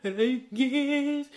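high, slow, tearful wail through sobs; retold crying. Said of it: "still crying".

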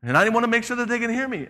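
indignant mock shout; mock yelling. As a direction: play the person shouting to be heard.

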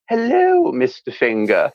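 high, bright-forward RP greeting to a finger; front-of-mouth RP. Retold or imitated. Dialect coach showing the class RP placement. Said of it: "speak in, in the front of your mouth".

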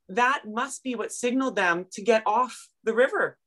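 ordinary talk, nothing marked

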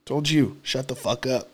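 low, soft re-enacted telling-off; quiet, low retold threat; muted retold warning. Retold repeat of the demand, muted.

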